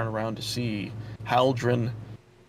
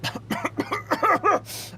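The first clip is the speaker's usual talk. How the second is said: coughing back to life. Acted. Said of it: "He goes".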